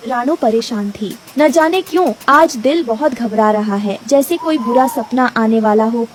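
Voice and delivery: usual speaking voice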